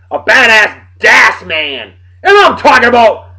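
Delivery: loud hype shout. Real, not acted. Hyping up a friend.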